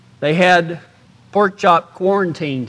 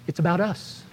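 much softer, near-whisper "us"; 3 semitones lower, 13 dB quieter than usual. Shown as a demonstration. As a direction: drop to a hush on "us". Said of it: "contrast others / us".

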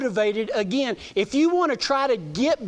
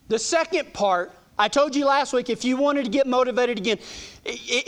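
ordinary talk, nothing marked

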